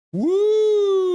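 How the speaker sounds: very high, loud whooping cheer; loud woo. Real, not acted. Streamer joining the Toronto wave, a high whoop. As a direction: whoop like a fan in the arena.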